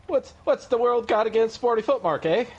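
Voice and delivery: high hammed-up mock outrage. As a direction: squeak it out like a cartoon.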